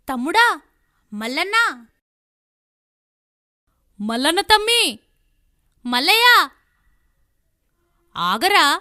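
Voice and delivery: loud rising calls from afar; calling after him. Acted. Sister calling after her angry brother Mallanna, loud rising calls. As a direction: call out to stop your brother.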